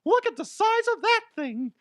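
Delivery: very high squeaky cartoon mouse; Mickey Mouse voice. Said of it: "That sounds like Mickey Mouse".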